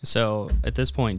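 ordinary talk

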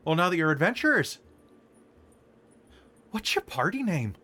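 high, cheery Canadian with eh; Canadian shopkeeper. Acted. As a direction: ask the big question eagerly. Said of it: "leans out and goes".

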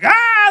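put-on high, loud "ah" of screaming kids; mock kid scream. Dad imitating his noisy kids at home, mock scream. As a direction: imitate the din of screaming children. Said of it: "they're screaming and they're singing".

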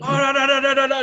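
loud, high stream of protest; imitating a chief's frantic objection; mock yelling. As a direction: rattle off outraged refusals, high and loud. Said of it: "just started yelling".

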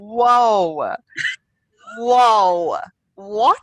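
loud, drawn-out whoas rising to a high what; shocked disbelief. Retold or imitated. Viewer reacting to the husband's outburst with loud shocked whoas. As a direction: recoil at what you just heard. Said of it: "And you're just like".

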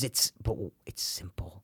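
soft unvoiced whisper. Real, not acted. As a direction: breathe it out in a whisper. Whispered aside.

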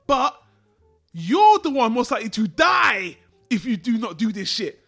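rising into a loud shout at the end; retold shout. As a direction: yell it at him like you've lost patience. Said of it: "I'm like".

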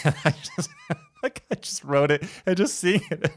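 high giggly laugh while talking. Real, not acted. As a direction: laugh through the words. Laughing at own prayer journal.

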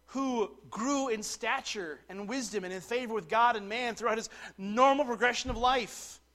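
emphatic preaching. Real, not acted. Preacher to congregation on God clothed in flesh, emphatic preaching. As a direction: drive the doctrine home with full voice.

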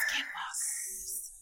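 slow, eerie whisper; spooky whisper. Pretend. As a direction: draw the word out in a hush. Spooky naming of the monster.

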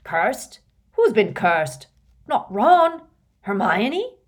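loud, shocked questions; alarmed questioning. A narrator's reading. Hagrid hearing someone is cursed, alarmed. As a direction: fire off questions in rising alarm.

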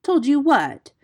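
high, loud insistent question; sharp demand. A narrator's reading. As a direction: press hard for the rest. Mongoose demands the unfinished answer.

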